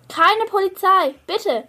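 urgent plea to his family; pleading. A narrator's reading. Anton begs his parents, a high urgent plea. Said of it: "fleht Anton".

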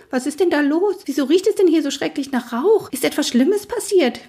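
high, anxious rapid questions; agitated neighing. Acted. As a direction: fret about the smoke smell. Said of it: "wieherten ihm seine Stallkameraden aufgebracht".

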